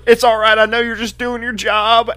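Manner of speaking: high, loud imitated weeping; hammed-up tearful acceptance, dragged out; mock sobbing. Heartbroken man to the prison guard, mock sobbing. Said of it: "He's like, sobbing".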